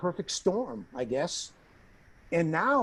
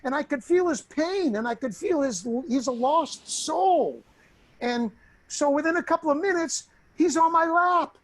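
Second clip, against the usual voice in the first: ordinary talk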